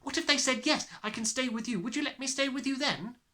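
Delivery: put-on high, bright eager child; eager cry. A narrator's reading. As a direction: jump at a new hope. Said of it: "Matilda cried eagerly".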